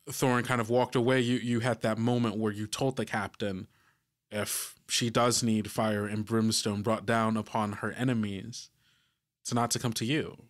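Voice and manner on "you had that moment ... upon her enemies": ordinary talk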